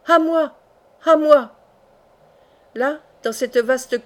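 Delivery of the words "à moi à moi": loud, high cry for rescue; great cry for help. A narrator's reading. Hardy calls for help as he is dragged away, loud desperate cry. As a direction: call out sharply, pitched high and loud. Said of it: "il jeta un grand cri".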